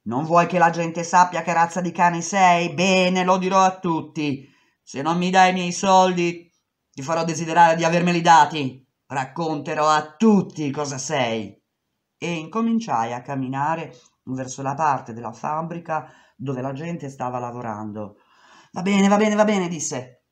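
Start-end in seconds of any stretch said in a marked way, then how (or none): 0.0-11.6 angry threat. Retold or imitated. She threatens to expose him. 18.7-19.7 loud giving in. Retold or imitated. Boss gives in.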